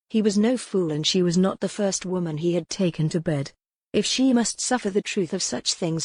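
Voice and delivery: ordinary delivery, nothing marked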